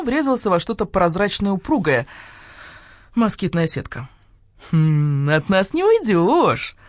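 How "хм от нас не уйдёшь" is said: slow, gloating and pleased; satisfied gloating. Acted. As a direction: savour the threat, very slowly. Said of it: "удовлетворённо заулыбалась". (удовлетворённо и злорадно)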